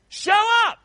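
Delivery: high, loud preached cry; preached shout. Real, not acted. Preacher rallying his congregation, loud high shout. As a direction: call it out to the church.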